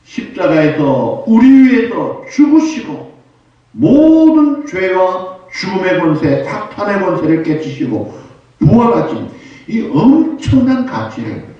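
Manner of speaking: loud, high, slow pulpit proclamation; preaching. Real, not acted. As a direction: stretch the key words in full-force preaching. Preaches at full force. (높고 크게, 길게 끌며 힘껏 설교)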